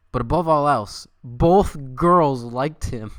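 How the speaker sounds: loud, very slow emphasis; hammed-up climax, dragged out; dramatic reveal. A narrator's reading. Streamer reading the fanfic's big reveal, loud and slow.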